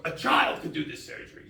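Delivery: mock-dramatic doctor declaring it's easy; overdramatic TV doctor. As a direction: ham it up like a sixties TV actor. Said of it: "He gets, like, very dramatic".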